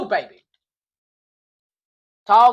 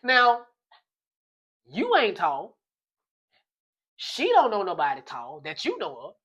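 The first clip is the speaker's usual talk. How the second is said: ordinary talk, nothing marked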